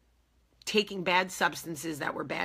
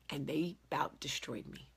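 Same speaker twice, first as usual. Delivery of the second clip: whispering; 4 semitones lower, 7 dB quieter than usual. Real, not acted. Painful past, whispered aside.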